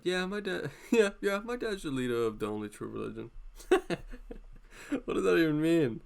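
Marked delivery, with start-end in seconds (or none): 0.0-3.3 put-on smug lying-kid brag, breaking into laughter; the kid who lies about his dad; bragging kid voice. Schoolkid bragging to friends, smug and laughing. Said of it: "How do I do that accent so well?".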